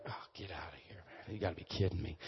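soft, distant scoffing mutter; scoffing buddies. Retold or imitated. Teen buddies scoff at the healed friend, softly and distantly. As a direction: brush it off under your breath.

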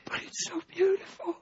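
high, soft, tearful mock southern lady; imitating a tearful woman. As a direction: quote her through her tears, softly. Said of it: "she starts crying".

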